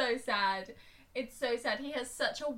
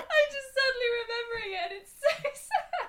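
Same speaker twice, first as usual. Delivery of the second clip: high, squeaky half-crying, half-laughing; tearful laughing wail; 11 semitones higher, 4 dB louder than usual. Real, not acted. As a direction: choke up with laughter and tears. Overcome by remembering the sad film.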